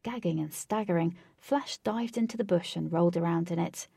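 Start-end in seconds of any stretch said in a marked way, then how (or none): none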